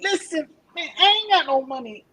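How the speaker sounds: [name]. Acted. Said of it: "Yo, [name] be like".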